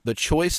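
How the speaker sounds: "choice" slow and weighty; stress on "choice". Real, not acted. Host stressing the key word to listeners, slow emphasis on "choice". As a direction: stretch out "choice" to make it land. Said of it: "I want to stress that word".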